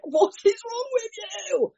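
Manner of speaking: high, drawn-out mock wail; stretched-out, squealed mock exasperation; exasperated partner. Acting out exasperated partners' question.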